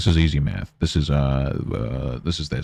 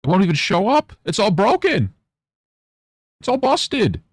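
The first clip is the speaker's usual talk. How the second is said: put-on much higher, babyish whine; squeaky baby-talk complaint; mock whiny child; 3 dB louder than usual. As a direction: whine like a toddler whose toy broke. Mock whining about broken alerts.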